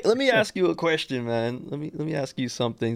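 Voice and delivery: ordinary talk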